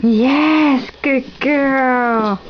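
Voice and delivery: gasp, then drawn-out delighted praise; excited praise. Real, not acted. Cheers toddler's correct answer.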